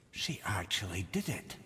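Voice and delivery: whispered in awe; stunned whisper. Acted. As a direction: breathe it out, shaken. Awed discovery of imprisoned Valkyrie.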